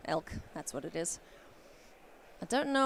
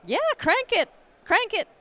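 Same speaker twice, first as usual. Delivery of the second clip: much higher, loud cheering call; excited shout; 11 dB louder than usual. Real, not acted. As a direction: egg them on with a loud cheer. Urging producer to speed up.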